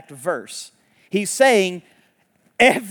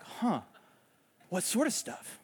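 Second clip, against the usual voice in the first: mock innocent question, softer; playing coy; 2 semitones higher, 12 dB quieter than usual. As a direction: feign ignorance, lightly. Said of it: "the resurrected Jesus can play coy".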